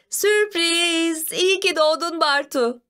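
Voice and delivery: high, celebratory exclamation; excited surprise greeting. Acted. Family surprises the birthday boy, excited high cheer.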